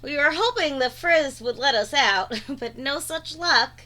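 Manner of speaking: usual speaking voice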